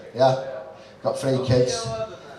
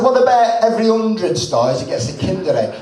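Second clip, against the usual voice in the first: louder, laughing cheeky offer; cheeky shout; 4 semitones higher, 3 dB louder than usual. Retold or imitated. Husband to wife, laughing cheeky offer. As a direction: pitch a stingy deal with a grin. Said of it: "I said".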